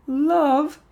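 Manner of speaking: loud, very high-pitched outburst; shrill exclamation. A narrator's reading. Amy to the crowd about the card, exclaiming. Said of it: "exclaimed Amy".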